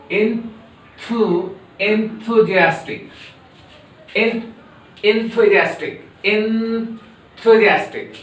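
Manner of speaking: enthusiastic drawn out slowly; slow, careful enthusiastic; slow model. Shown as a demonstration. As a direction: spell out enthusiastic syllable by syllable. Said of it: "Pronounce like me, but rapidly".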